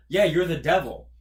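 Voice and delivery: loud flat call-out; loud knowing quip. Retold or imitated. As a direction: say it loud like you caught him. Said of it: "I'd be like".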